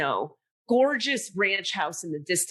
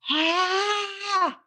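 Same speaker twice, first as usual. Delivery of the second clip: sudden high yelp of shock; 10 semitones higher than usual. Retold or imitated. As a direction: let out a startled ah. Shock at seeing flag.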